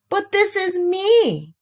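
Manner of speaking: high and slow, bewildered; very surprised. Acted. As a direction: sound truly taken aback. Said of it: "very much surprised".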